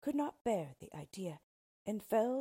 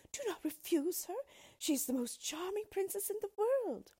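much higher pleading cry; great distress; 13 semitones higher, 4 dB louder than usual. Acted. Queen begging dwarf to take her daughter, distressed. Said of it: "said the queen in great distress".

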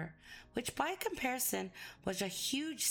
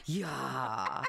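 "Ya" said much lower, falling; 8 semitones lower, 3 dB louder than usual. Shown as a demonstration. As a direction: drop "Ya" right down. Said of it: "We say it lower".

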